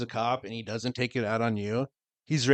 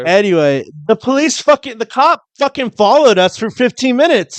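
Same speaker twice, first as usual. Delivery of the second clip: louder and higher, pushing over the teasing; loud and worked up; 10 semitones higher, 12 dB louder than usual. Real, not acted. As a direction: plough on, fired up about the cop. Cutting back in over teasing.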